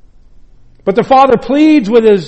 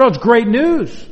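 bright, higher, eager good news; happy servant; 5 semitones higher than usual. Acted. Preacher voicing the servant with glad excitement. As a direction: announce the joyful homecoming to the brother. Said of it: "And the servant said".

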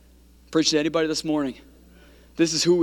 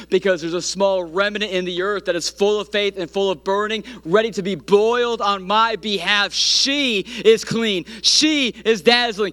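higher, louder, slowing pulpit declaration; building preaching climax; 6 semitones higher, 4 dB louder than usual. Real, not acted. Preacher proclaims the bride ready for her husband, exultant and building. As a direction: swell to full force, stretching each phrase.

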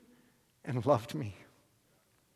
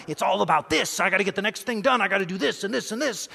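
frantic task list; 4 semitones higher, faster than usual. Retold or imitated. Self mid-task, listing compulsive duties.

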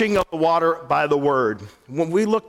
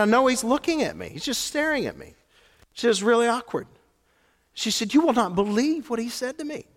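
wife voiced higher; 7 semitones higher, faster than usual. Wife retold, voice raised.

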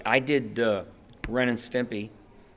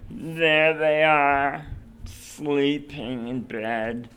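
very slow, small ghostly voice; wishy-washy ghost; 3 semitones higher, much slower than usual. Acted. Ghost pointing out the sleepers, very slow and small. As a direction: creep it out gently, drawn out. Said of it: "break character from this little".

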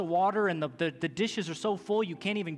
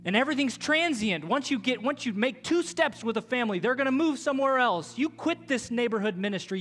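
fiery preaching; 6 semitones higher, 4 dB louder than usual. Real, not acted. Preaching at full force.

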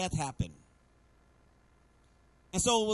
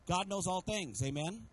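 ordinary talk

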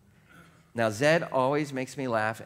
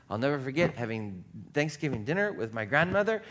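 ordinary delivery

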